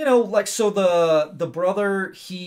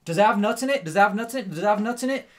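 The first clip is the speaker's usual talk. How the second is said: higher, louder, insistent repeated question; mock family question; 6 semitones higher, 6 dB louder, faster than usual. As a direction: keep pressing the girl about nuts, loud. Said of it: "everyone in her family asks her".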